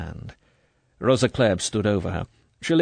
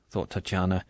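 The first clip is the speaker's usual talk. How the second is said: plain reading voice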